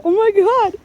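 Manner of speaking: panicked squeal. Real, not acted. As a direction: squeal in real alarm. Panicking in deep snow with dog.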